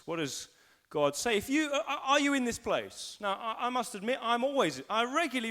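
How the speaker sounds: ordinary talk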